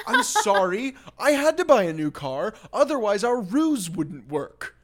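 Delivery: hammed-up smarmy excuse; mock fake apology. Sam apologising to Kelly for the new car, mock smarmy.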